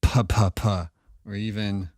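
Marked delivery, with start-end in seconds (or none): 0.0-0.8 firm puh sounds, slightly back from the mic; diffused puh demo. Shown as a demonstration. Showing diffused plosives to viewers, firm puh sounds. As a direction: pop the P's a touch away. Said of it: "pull it out just a little bit".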